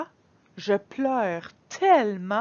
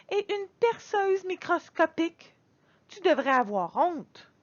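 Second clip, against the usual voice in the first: indignant scolding; 7 semitones higher than usual. Acted. Girl scolds her father.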